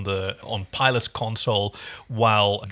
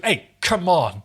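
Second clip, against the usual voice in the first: pleased guy; 5 semitones higher, slower than usual. Retold or imitated. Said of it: "He goes".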